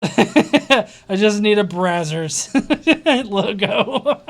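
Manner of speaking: talking through laughter; loud, laugh breaking into the words. Real, not acted. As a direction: crack up at your own joke mid-sentence. Cracking up at own joke.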